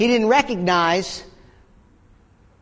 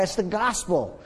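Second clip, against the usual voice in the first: normal talking voice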